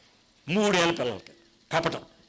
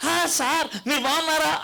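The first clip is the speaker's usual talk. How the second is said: high, hearty mock hello; husband greets the guest with exaggerated loudness; loud greeting; 8 semitones higher than usual. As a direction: greet the guest over-loudly. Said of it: "అని ఆడు కూడా వస్తుంది".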